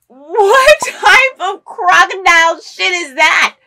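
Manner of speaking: loud, high, drawn-out outcry; outraged disbelief. Real, not acted. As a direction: stretch out the indignant question. Outraged reaction to show.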